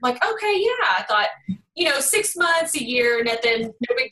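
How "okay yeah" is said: imitating her younger self, pitched well up and bright; eager yes. Retelling saying yes to her dad, mock-eager. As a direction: chirp a quick, eager agreement. Retelling agreeing to dad's offer.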